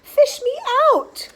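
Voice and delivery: very high, drawn-out loud wail; desperate yell. A narrator's reading. Boy begging to be pulled out, desperate yell. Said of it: "he yelled".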